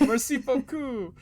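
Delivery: high, slow hammed-up French; mock French with a flourish; French accent. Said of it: "end it with mon ami".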